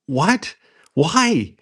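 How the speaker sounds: high, mock-startled yelp; startled what. Owner hearing a teammate admit it, high startled yelp.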